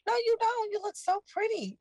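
high, sugary coaxing; sweet coaxing voice. Retold or imitated. High, sweet coaxing to the girl. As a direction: coax her like a trusted adult. Voicing the woman flattering her.

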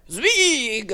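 curt mock German; German accent. German immigrant giving his surname, mock German accent. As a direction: answer in a put-on German accent. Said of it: "the German guy was like".

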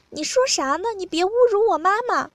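high, quick, loud objection; indignant protest. A narrator's reading. Partner objects to an insult of their mother, indignant protest.

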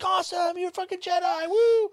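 high, whooping fan cheer; excited cheering. Retold or imitated. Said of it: "people would have been like".

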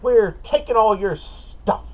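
high, loud goblin bark. Acted. Goblin robbing the cart, high loud shout. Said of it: "it's a goblin with a red cape on. And it says".

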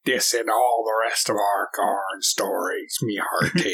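low, put-on pirate voice. Player threatens to run every story like this, pirate. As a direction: play a hammy pirate, low and rolling. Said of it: "talk like a pirate".

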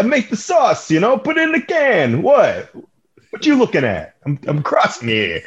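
high-pitched, hammed-up Italian chef accent; Chef Boyardee. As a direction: play a cartoon Italian chef from a pizza box. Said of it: "He sounds like Chef Boyardee".

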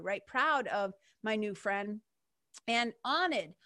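ordinary talk

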